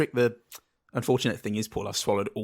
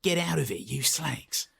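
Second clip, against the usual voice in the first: lightly imitated Cockney hard man; mock movie tough guy seeing off villains; tough-guy film impression; 3 semitones higher, 3 dB quieter, slower than usual. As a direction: throw it out like a film copper. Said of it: "That's pretty good".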